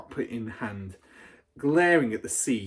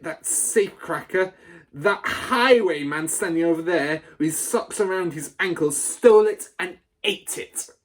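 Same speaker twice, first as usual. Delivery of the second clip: loud, high, outraged accusation; furious rant; 4 semitones higher, 6 dB louder than usual. A narrator's reading. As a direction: rant at the boy before the school. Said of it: "the Trunchbull shouted".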